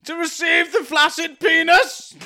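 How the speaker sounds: high, slow theatrical proclamation; mock-grand declamation.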